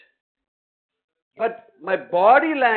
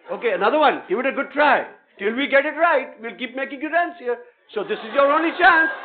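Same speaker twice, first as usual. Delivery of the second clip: energetic coaching; 6 semitones higher, 10 dB louder than usual. Real, not acted. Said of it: "teacher coaching loudly, energized".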